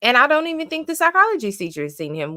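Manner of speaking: high, incredulous teacher voice; quoted teacher. Retold or imitated. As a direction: voice the teacher's disbelief. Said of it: "She's like".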